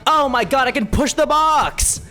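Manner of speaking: normal talking voice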